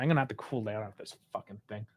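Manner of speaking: quiet fuming aside; low muttering. Real, not acted. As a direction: grumble it under your breath. Fuming over a bad result.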